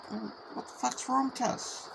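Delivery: high-pitched retold question to a mate; baffled concern. Biker asks his upset cousin what's wrong, high-pitched concern. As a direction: ask it high, a little hushed.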